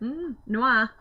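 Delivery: noir said the French way, nasal and drawn out; a playful French noir; French accent. Pretend. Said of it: "go all French on me".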